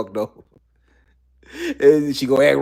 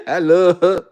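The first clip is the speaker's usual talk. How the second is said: laughing through it; 4 semitones higher, 5 dB louder than usual. Retold or imitated. Laughing repeat of the quote.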